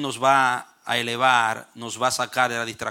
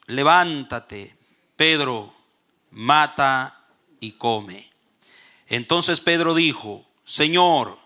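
scripture reading; 3 dB louder than usual. Acted. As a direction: read the verses out solemnly, with weight on key words. Reads Bible passage aloud.

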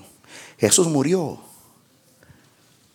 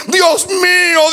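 anguished cry; 5 semitones higher, much slower than usual. Acted. Preacher voicing Jesus crying out on the cross, slow anguished shout. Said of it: "Esto es".

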